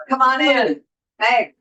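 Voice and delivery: ordinary delivery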